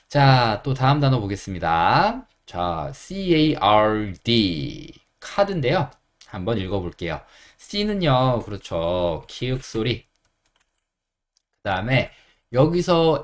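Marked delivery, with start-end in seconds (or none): none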